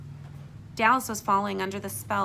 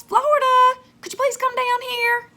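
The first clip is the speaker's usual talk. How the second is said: high, carrying call down the stairs; calling woman voice; 11 dB louder than usual. Acted. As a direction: call out brightly from another room. Woman calls the girl downstairs.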